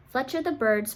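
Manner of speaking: plain reading voice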